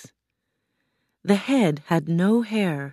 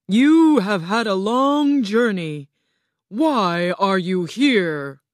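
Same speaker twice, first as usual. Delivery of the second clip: loud, grand, drawn-out voice; booming Oz; 4 semitones higher, 6 dB louder than usual. A narrator's reading. Oz questions the travelling girl, booming. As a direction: boom it out like a mighty wizard.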